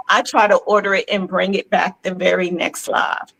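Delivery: usual speaking voice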